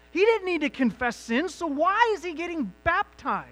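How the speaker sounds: high, loud impassioned preaching; full-force preaching. Acted. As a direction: lift it up and drive each question home to the congregation.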